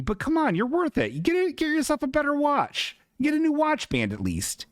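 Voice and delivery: high teasing nag; mock scolding. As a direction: tease them in a high sing-song.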